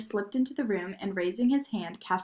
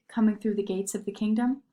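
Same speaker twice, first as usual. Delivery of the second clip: plain reading voice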